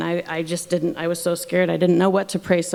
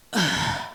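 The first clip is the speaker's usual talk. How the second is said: breathy, voiceless huff of exasperation; short exasperated kid's sigh; 4 dB quieter than usual. A narrator's reading. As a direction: huff like an eye-rolling kid. Baby Bear's eye-rolling exasperation repeated.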